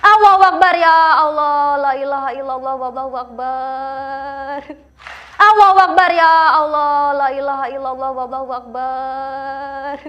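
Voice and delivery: frantic shouted takbir. Real, not acted. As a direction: cry out to God, then drag the last words.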